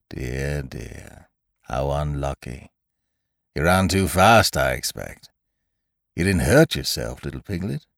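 lightly voiced Eeyore. A narrator's reading. Eeyore asking if Piglet hurt himself, resigned concern. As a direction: console mildly, with a weary air.

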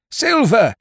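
high, loud cheer; rousing pirate shout; crew's cry. A narrator's reading. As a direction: hail the leader at the top of the voice. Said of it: "they cried".

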